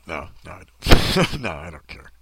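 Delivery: ordinary talk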